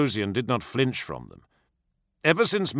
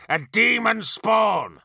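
higher, louder, slow accusation; slow shouted denunciation; accusing shout; 12 semitones higher, 4 dB louder, slower than usual. A narrator's reading. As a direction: hurl the words at him. Verosian accusing the demon.

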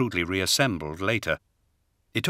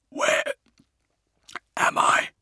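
croaking whisper after a long coma; barely there, raspy voiceless question; slower than usual. A narrator's reading. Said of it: "The sound of his voice surprised him".

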